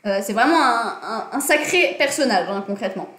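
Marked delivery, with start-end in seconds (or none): none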